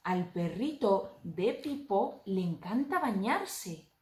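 slow, gentle picture-book reading; reading to a toddler. Acted.

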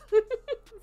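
sudden high giggle; burst of laughter. Real, not acted. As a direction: break into a laugh you can't hold. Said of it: "I was trying to say that without laughing".

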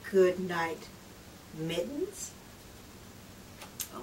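usual speaking voice

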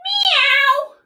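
high playful cat meow. Pretend. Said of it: "lift your back up and say".

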